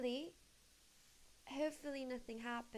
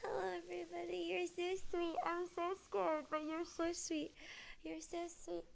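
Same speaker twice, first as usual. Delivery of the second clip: higher, jittery greeting; higher nervous chatter to viewers; nervous excitement; 5 semitones higher than usual. Real, not acted. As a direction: greet chat with nervous energy.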